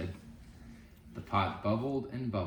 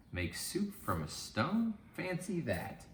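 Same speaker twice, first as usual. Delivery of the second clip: put-on high, slow old woman's wonder; amazed old lady; 10 semitones higher, slower than usual. Old lady marvelling at soup from a stone, high and slow.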